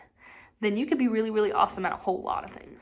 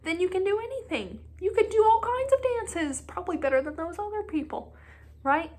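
higher, brisk encouraging voice; encouraging friend; 7 semitones higher, faster than usual. Retold or imitated. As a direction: cheer him on, quickly. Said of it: "talk some sense into him".

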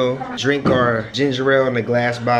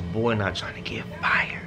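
whispering; 5 dB quieter than usual. Real, not acted. Whispering about work.

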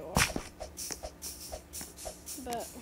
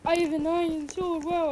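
lightly voiced player, a touch higher and louder; mock player voice; 4 semitones higher, 8 dB louder than usual. Gamer imagines other players bragging, mock awe. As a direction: imitate an awed player, pushed up and louder. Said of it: "And they're like".

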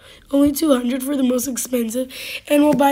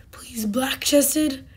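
whispered plea; 5 dB quieter, much slower than usual. Real, not acted. Hoping for a rare pheasant.

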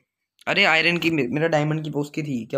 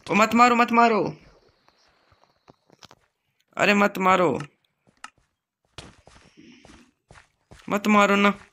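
loud, high cry to stop; urgent plea; 6 semitones higher, 5 dB louder, slower than usual. Real, not acted. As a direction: plead loudly, voice pitched up. Pleads with players to stop hitting.

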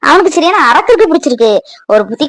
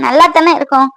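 ordinary talk

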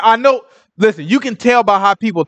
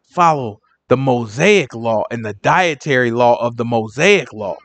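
mock explaining; 9 semitones lower than usual. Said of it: "They like".

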